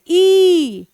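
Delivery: "ई" stretched out slowly; long ee sound. Shown as a demonstration. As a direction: hold "ई" as one long vowel. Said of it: "एक ही sound निकलेगा". ("ई" को खींचकर लंबा बोलें)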